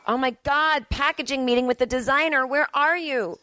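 high-pitched urgent imitation of a text; mock frantic texter. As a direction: read the text in a high, panicky put-on voice. Voicing the frantic text he receives.

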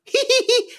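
high-pitched little giggle; little kid's giggle. Acted. Little kid poking a goblin, high giggle. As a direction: giggle like a tiny child. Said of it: "and she goes".

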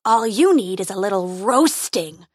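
roaring. Acted. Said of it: "he roared".

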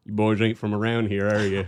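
deep-voiced lawman sizing up strangers; sheriff impression. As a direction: menace the city boys like a small-town sheriff. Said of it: "like a sheriff, is just gonna be like".

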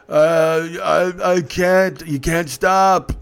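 lightly acted drunk, words dragged out; slow, halting drunk protest; drunk passenger. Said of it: "the drunk dude behind me".

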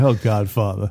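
low character impression without the nasal tone; Johnny Fontane. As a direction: groan it low to the Don. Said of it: "He doesn't have that".

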